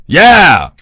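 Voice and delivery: loud, high ghost yes. Acted. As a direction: belt out a hearty yeah. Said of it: "And then she said".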